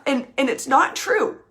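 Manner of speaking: ordinary talk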